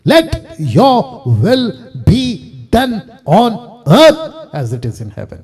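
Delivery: very slow, each word ringing out separately; proclaimed prayer. Acted. Preacher teaching congregation what to pray, slow solemn proclamation. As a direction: declare it word by word, hugely drawn out.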